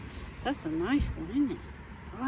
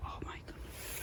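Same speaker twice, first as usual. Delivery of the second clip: real hushed whisper of amazement; awed whisper; 15 dB quieter than usual. Real, not acted. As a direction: whisper it to yourself in wonder. Awed at a fresh find.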